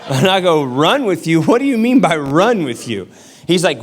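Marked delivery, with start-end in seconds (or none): 0.6-3.1 mock disbelieving question; retold incredulity, lively and questioning; incredulous echo. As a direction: throw the instruction back, baffled.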